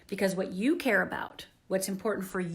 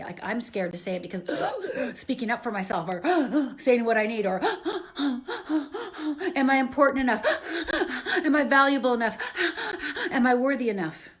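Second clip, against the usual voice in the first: acted tearful gasping; 3 semitones higher than usual. Shown as a demonstration. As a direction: voice rising into gasping sobs between questions. Demo of a scared confession.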